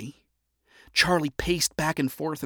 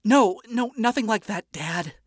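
awkward; 8 semitones higher than usual. A narrator's reading. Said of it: "Charlie awkwardly replied".

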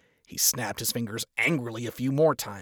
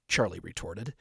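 usual speaking voice

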